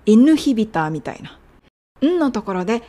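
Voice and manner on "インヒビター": インヒビター with the ン said as a light ヌ; tongue touches the teeth on ン of インヒビター. Shown as a demonstration. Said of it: "ぬというつもりで言ってみて".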